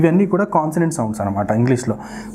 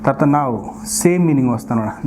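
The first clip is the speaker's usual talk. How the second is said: ordinary talk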